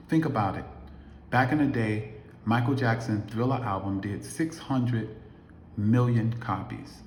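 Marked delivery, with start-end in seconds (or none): none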